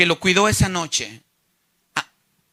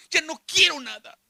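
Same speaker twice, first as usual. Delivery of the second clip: higher-pitched acted refusal; rejecting outburst; 7 semitones higher than usual. Judas arrojando las monedas que tenía, rejecting outburst. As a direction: throw it off in a raised pitch. Judas throwing the coins away.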